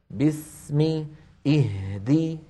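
"باسم، اهدي" in matched measured timing; equal letter length. Shown as a demonstration. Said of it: "نفس الطول".

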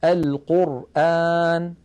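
القرآن drawn out slowly with a full, heavy ra; تفخيم الراء ببطء; heavy r in Quran. Shown as a demonstration. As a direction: stretch القرآن and make its r thick. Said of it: "مثلًا إيش؟".

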